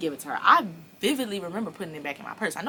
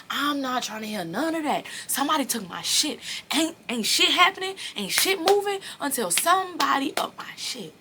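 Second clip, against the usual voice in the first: rising to a high furious rant; angry; 8 semitones higher than usual. Retold or imitated. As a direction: pitch climbing as she loses it. Furious about stolen money.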